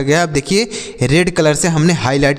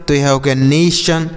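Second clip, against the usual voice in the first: ordinary delivery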